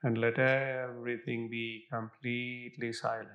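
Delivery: guided meditation. Acted. Guided visualization meditation continues.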